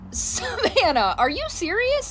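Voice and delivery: name drawn out slowly, then a high questioning rise; incredulous. Retold or imitated. Mom catching daughter's faked illness, drawn-out incredulous. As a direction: disbelief at the sudden recovery. Mom reacts to sudden recovery.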